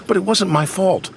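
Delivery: high, plaintive excuse; sad protest. A narrator's reading. Percy blaming the diesels to Sir Topham Hatt, plaintively. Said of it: "Percy said sadly".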